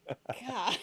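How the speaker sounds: usual speaking voice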